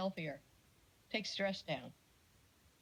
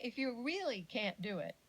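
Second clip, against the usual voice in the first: ordinary talk, nothing marked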